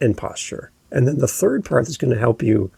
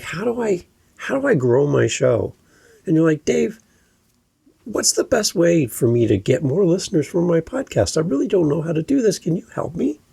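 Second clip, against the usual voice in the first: higher-pitched listener's question, rising on "help me"; real-question upspeak; 5 semitones higher than usual. Shown as a demonstration. Puzzled podcaster asking the coach for help, rising question inflection. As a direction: plead upward, lifting "me" at the end. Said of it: "follow up with a real question".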